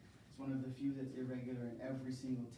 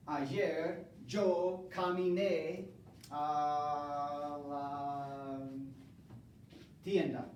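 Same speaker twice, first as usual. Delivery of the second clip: slow model sentence; 3 dB louder, much slower than usual. Shown as a demonstration. Teacher modelling preterite for the class, slowly drawn out. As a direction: draw each word out for learners.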